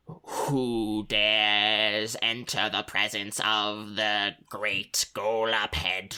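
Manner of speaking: slow, drawn-out voice of an ancient being; booming creature voice. Acted. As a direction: speak slowly from the dark like a hidden giant. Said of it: "you hear a voice that says".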